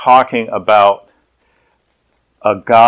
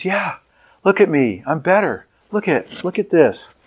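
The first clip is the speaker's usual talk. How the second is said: healed woman's joy; 7 semitones higher, 4 dB quieter than usual. Retold or imitated. Healed woman shows herself off.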